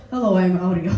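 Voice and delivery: a low-pitched deadpan introduction; imitating fellow comedian. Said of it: "he went".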